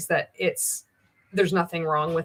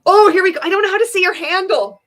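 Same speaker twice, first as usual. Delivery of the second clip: sudden high, loud exclamation; loud outburst; 12 semitones higher, 11 dB louder, faster than usual. Real, not acted. Host faced with a viewer handle she can't pronounce, loud outburst. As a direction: burst out much higher and louder. Sudden alarm at unpronounceable username.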